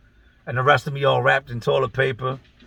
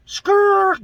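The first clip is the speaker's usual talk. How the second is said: high screeching tire skid sound; skid noise; 5 dB louder than usual. Retold or imitated. Stopping the story with a high screech sound. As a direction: screech to a halt like brakes.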